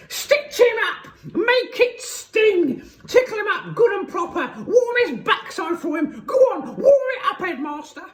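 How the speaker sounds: frenzied high, loud screeching; Missus Pratchett. A narrator's reading. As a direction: screech wild encouragement at the caning. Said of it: "shrieked Missus Pratchett".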